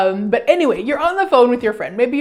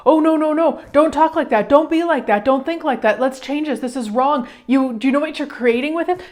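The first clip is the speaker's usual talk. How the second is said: higher-pitched, jumping in to correct someone; urgent objecting; 4 semitones higher than usual. Acted. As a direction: cut in with alarmed, insistent protests. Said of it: "most of us jump in and go".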